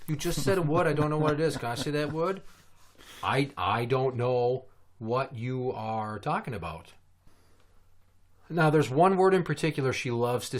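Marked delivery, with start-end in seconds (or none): 0.1-2.4 put-on higher, insistent little-kid voice; imitated eager child. Daughter catching parent swearing, higher mock child voice. 3.2-6.9 slow, deliberately spaced denial; feigned ignorance. Retold or imitated. Parent denying a swear to daughter, slow feigned innocence.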